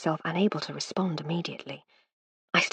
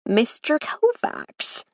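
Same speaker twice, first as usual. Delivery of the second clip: almost laughing; 5 semitones higher, much slower than usual. Acted. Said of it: "she said, almost laughing".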